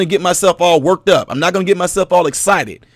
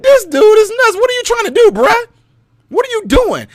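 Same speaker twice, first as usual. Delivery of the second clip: much higher, incredulous; mock bewildered crowd. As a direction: sound baffled and offended. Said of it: "They're gonna be like".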